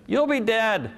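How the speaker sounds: put-on high, matter-of-fact child's voice; a carefree child stating the obvious; little daughter's voice. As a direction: deliver it bright and innocent like a little girl. Father voicing young daughter punchline.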